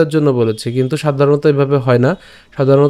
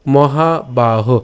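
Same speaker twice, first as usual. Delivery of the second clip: মহাবাহ stretched very slowly as mo-ha-ba-ho; drawn-out word; much slower than usual. Shown as a demonstration. Teacher slowly modelling মহাবাহ for learners. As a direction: draw out মহাবাহ syllable by syllable. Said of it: "উচ্চারণটা কেমন হচ্ছে?".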